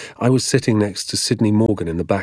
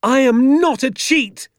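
high, slow, loud denial; outraged boy's defiant cry; furious shout back; 5 dB louder, slower than usual. A narrator's reading. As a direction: yell it in blind fury. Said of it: "I shouted back at him".

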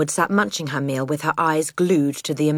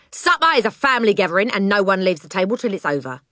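brisk, higher-pitched rebuke; snappish father; 4 semitones higher, faster than usual. Acted. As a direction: lay down the family rule irritably. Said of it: "he snapped".

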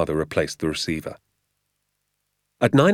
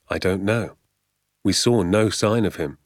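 ordinary delivery, nothing marked

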